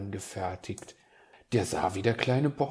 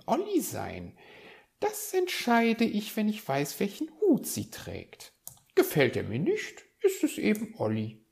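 high-pitched magpie, thinking aloud; magpie musing to herself; 9 semitones higher than usual. Acted. Magpie sorting out her suspects alone, musing.

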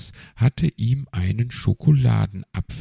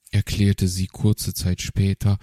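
plain reading voice

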